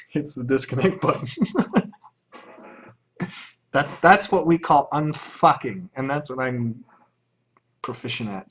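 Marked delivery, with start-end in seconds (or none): none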